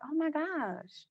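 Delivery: slow, high, hushed amazement; acted soft awe; dawning realization. Voices client's sudden realization.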